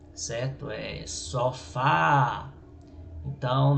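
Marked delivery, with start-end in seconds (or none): 1.1-2.5 "sofá" slowly, with FÁ held long and loud; stress on the last syllable. Shown as a demonstration. Teacher demonstrating the tonic syllable to students, stressed FÁ in "sofá". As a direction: stretch and push the FÁ of "sofá". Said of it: "for mais alta".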